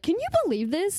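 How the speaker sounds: high, outraged quote to her husband; retelling how worked up she was; mock outrage at a TikTok claim; indignant disbelief. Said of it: "I was like".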